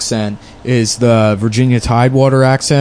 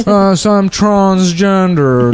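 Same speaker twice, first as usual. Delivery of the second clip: put-on slow, higher old-money Boston Brahmin accent; 6 semitones higher, much slower than usual. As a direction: stretch it out in a hammed-up Brahmin accent. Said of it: "They have that accent".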